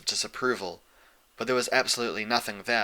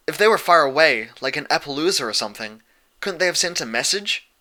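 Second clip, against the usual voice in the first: higher, louder urgent questioning; pressing for answers; urgent Dash; 5 semitones higher, 6 dB louder than usual. A narrator's reading. Dash pressing Celestia about the missing friends. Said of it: "Dash asked".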